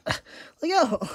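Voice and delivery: high-pitched plea to the kitten; high pleading. Real, not acted. Begging the clinging kitten, high-pitched plea. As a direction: squeak it out, begging the cat.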